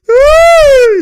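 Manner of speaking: loud, drawn-out high shriek; high excited whoop. Real, not acted. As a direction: burst into one long piercing cheer. Cheering a play.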